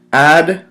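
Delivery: "Adequate" broken into three separate parts, loud first syllable. Shown as a demonstration. Teacher counting syllables for students, "Adequate" syllable by syllable. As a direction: say "Adequate" one syllable at a time, with long gaps. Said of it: "three syllables in adequate".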